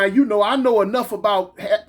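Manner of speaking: ordinary talk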